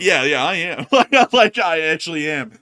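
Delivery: loud, high laughing agreement; laughing admission. Real, not acted. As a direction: own up to it, laughing loudly. Teased for being out of breath.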